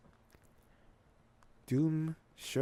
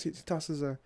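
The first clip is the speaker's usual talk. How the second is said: plain reading voice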